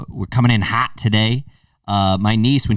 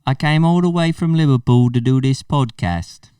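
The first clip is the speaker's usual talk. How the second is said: higher-pitched mock Scouse; put-on Liverpool accent; 6 semitones higher than usual. Visitor explaining his trip to the podcast, Liverpool accent. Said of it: "We could talk like this".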